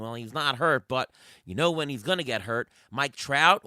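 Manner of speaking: ordinary talk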